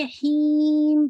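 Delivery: हीम loud, nasal right through the long ee; wrongly nasal stretch. Shown as a demonstration. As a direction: hum through the nose all along हीम. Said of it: "nasal sound के साथ कर रहे हैं". (हीम को शुरू से नाक में खींचकर)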